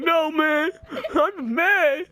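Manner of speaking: high, drawn-out mock whine; imitating crying cousin. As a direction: deny crying in a high, tearful put-on voice. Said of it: "He was crying".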